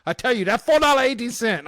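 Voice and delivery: high, loud mock Chinese accent shouting; yelling with a Chinese accent. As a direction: yell it like the shop lady through the window, in broken Chinese-accented English. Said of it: "And she said".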